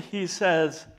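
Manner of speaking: usual speaking voice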